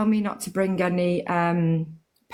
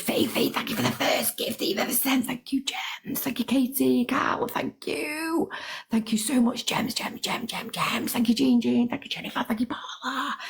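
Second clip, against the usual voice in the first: whispered thanks; 8 semitones higher than usual. Real, not acted. Thanking gifters in a breathy whisper.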